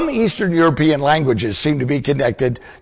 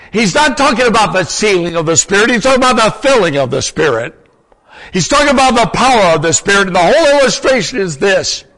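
higher, forceful preacher's delivery; impassioned preaching; 8 semitones higher, 4 dB louder than usual. Real, not acted. Preacher explaining the power of the Spirit to his class, impassioned. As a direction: drive the point home with raised pitch and insistence.

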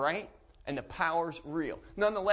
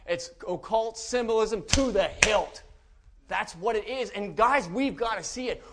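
louder, higher pulpit warning; fiery preaching; 5 semitones higher, 4 dB louder than usual. Acted. Preacher warning parents about movies, fiery and loud. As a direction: preach it, rising and driving.

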